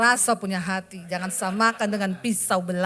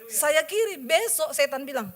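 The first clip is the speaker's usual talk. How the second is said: ordinary talk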